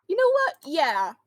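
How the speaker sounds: real abrupt exclamation; sudden outburst. Real, not acted. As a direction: blurt it out suddenly.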